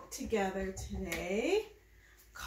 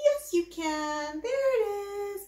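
delighted gasp; 8 semitones higher, 3 dB louder than usual. Acted. Praising baby finding nose.

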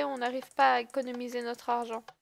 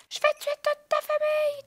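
higher, hammier shout; 12 semitones higher, 4 dB louder than usual. Acted. Menace reprise plus aiguë.

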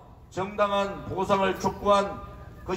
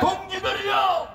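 roaring rallying speech; 11 semitones higher than usual. Real, not acted. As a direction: roar it to the crowd. Leader chants, crowd echoes.

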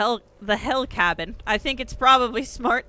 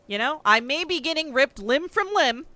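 ordinary talk, nothing marked

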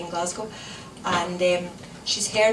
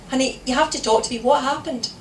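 higher, louder, urgent appeal; pleading wife; 5 semitones higher, 5 dB louder than usual. A narrator's reading. Wife begging silent husband to explain, urgent. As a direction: press for an answer, quickening.

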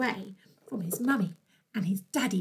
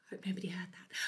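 soft conspiratorial whisper; 4 semitones lower, 12 dB quieter, faster than usual. Real, not acted. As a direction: whisper it like a secret. Whispered aside to children.

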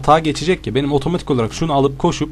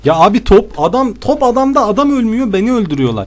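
frantic complaint; 8 semitones higher than usual. Real, not acted.

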